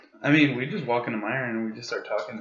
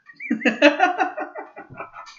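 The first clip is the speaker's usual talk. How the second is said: loud, high cackle; real laugh at the pun; high cackling laugh; 7 dB louder than usual. Real, not acted. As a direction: break into a shrieking laugh. Laughing at own joke.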